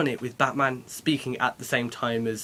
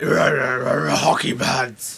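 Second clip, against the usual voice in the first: very slow, louder grumbly Batman; 6 dB louder, much slower than usual. Retold or imitated. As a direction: drag it out in a grumbly growl. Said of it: "Batman with his grumbly voice like".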